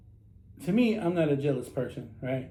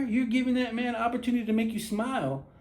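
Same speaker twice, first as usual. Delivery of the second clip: ordinary talk, nothing marked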